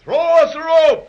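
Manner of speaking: high, loud call to the deck; shouting up. Acted. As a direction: yell it up to the crew. Shouting up to the ship.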